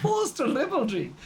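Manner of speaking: high, drawn-out mock delivery; hammed-up mock repeat. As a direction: say it with silly, exaggerated relish. Mock repeat of own phrase.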